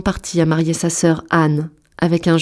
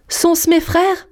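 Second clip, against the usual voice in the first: espoir anxieux; 11 semitones higher, much slower than usual. Acted. Épouse espère ses sauveurs.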